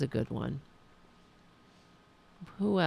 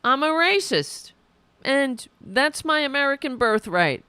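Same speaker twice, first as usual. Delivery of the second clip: mocking; 7 semitones higher, 3 dB louder than usual. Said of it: "You might as well also say".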